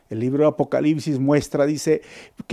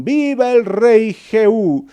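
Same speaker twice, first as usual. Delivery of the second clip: mock crowd cheer; 5 semitones higher, 4 dB louder, slower than usual. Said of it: "tomaron la trompeta y gritaron".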